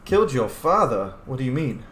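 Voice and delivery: ordinary talk, nothing marked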